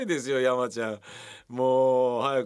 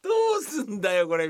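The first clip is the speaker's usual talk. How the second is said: high, fast, crying with laughter; laughing despair; 7 semitones higher, 3 dB louder, faster than usual. Retold or imitated. Laughing despair at the actor's giant prop. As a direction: throw up your hands laughing.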